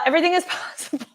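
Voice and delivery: real giggle taking over the phrase; laughing. Real, not acted. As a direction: laugh through the sign-off. Laughs while signing off.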